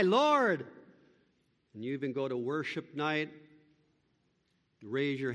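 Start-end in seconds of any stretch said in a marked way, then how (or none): 0.0-0.7 loud, high cry of Lord; anguished appeal; pleading cry. Retold or imitated. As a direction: call out in desperation. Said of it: "It's a cry".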